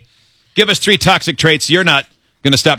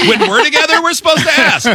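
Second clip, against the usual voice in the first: much higher mocking tone; mock prissy; 14 semitones higher than usual. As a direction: parody the polite rule in a high voice. Mocking the rule.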